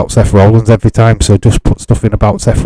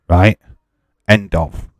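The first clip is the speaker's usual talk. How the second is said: normal talking voice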